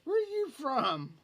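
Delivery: high, loud, stretched disbelief; incredulous outburst. Retold or imitated. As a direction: exclaim it in mock bafflement, pitch way up. Incredulous inner question about Leroy.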